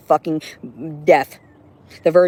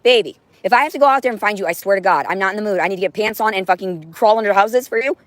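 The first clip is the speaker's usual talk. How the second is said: calm turning angry; 6 semitones higher, 3 dB louder than usual. Real, not acted. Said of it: "I'm getting mad".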